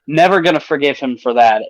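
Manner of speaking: normal talking voice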